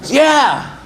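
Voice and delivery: high-pitched squeaky yes; eager yes. Acted. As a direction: jump at the offer. Guy eagerly agrees.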